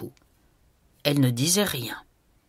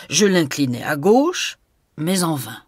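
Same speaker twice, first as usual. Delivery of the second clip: usual speaking voice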